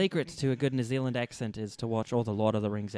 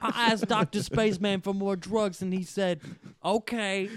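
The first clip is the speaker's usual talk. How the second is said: high, drawn-out comic impression; slow, high celebrity voice; Tracy Morgan; 7 semitones higher, slower than usual. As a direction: pitch it up and stretch it out as caricature. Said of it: "You got that Tracy Morgan".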